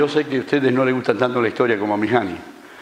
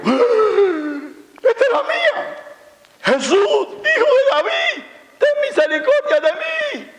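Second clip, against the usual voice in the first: very high, drawn-out desperate call; crying out. Acted. Blind man calling to Jesus over the crowd, high desperate cry. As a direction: cry out for mercy with long, high syllables. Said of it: "no se nos dice cuántas veces gritó".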